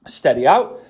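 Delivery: usual speaking voice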